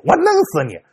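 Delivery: put-on an old man at his gate threatening a lawsuit, furious. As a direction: spit out the threats. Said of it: "这老头在这骂街".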